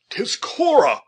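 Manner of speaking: high, loud exclamation of horrified joy; a wild cry, pitched high; horror mingled with delight. A narrator's reading. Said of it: "horror and delight were wildly mingled".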